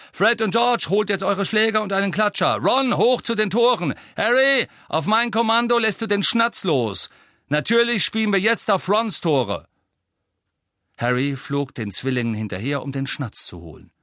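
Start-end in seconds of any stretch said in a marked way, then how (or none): 0.1-9.7 high, loud captain's commands; shouted orders. A narrator's reading. Angelina sends players to their places, shouted orders. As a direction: fire instructions across the pitch. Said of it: "rief Angelina".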